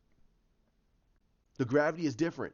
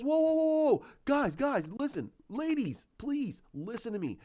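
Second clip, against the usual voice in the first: urgent retold plea; 9 semitones higher, slower than usual. Hero begging the team to listen, high urgent plea. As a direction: call out high, then plead for attention.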